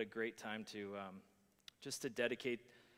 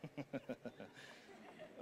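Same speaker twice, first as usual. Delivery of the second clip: breathy laugh; 10 dB quieter than usual. Real, not acted. Laughing at own joke.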